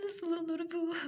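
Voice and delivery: loud sobbing disbelief; anguished cry. Acted. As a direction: cry it out in despair. Grieving wife, disbelief.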